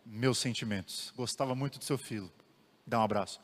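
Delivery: quiet, tender condolences to a grieving mother; gentle condolence. Shown as a demonstration. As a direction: offer sympathy softly. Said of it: "melhor coisa que eu poderia ter falado".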